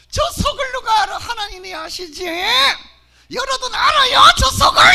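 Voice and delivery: teasing shout. Acted. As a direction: call it out to the whole hall. Teases the pastor before the crowd.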